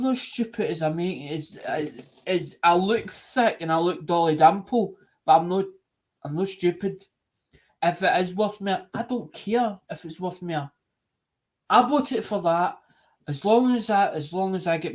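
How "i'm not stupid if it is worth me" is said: normal talking voice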